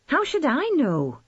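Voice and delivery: fed up with questions, bite back; ill-tempered shout. A narrator's reading. Rachel losing patience with her mother, snapping ill-temperedly. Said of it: "It came out as an ill-tempered shout".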